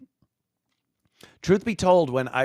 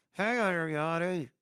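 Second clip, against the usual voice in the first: talking without moving the lips; lips barely moving; 6 semitones higher than usual. Shown as a demonstration. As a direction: ventriloquist style, mouth nearly shut. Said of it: "I'll talk like a ventriloquist".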